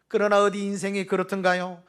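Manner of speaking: ordinary delivery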